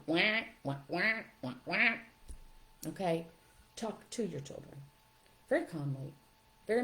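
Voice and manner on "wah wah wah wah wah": muted trombone wah wah, mock adult droning; Charlie Brown adult voice. Parent heard as noise by the kids, mock Charlie Brown wahs. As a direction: drone wordless wahs like the cartoon teacher. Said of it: "It's like the Charlie Brown".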